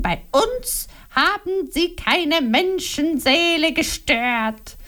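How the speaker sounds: mock her husband's excuses with scorn; Liliana, accusing. A narrator's reading. Wife accusing her husband before Momo, scornful.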